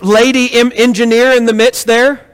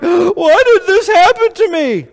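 put-on high, tearful child's whine; a parent mimicking a crying child; whiny child; 6 semitones higher than usual. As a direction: play the hurt kid asking why. Child with tears in eyes.

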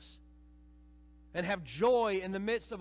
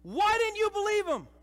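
much higher, loud exasperated question; rebuking Jesus; 9 dB louder than usual. Retold or imitated. Jesus rebuking the disciples, loud exasperated question. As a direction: demand it in disbelief. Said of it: "And Jesus is saying".

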